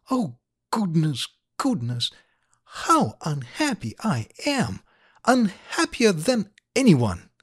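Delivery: put-on little girl crossly stressing each word; angry, emphatic child. A narrator's reading. Little girl complaining to her father after a long silence, angry and emphatic. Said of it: "said angrily, emphasizing each word".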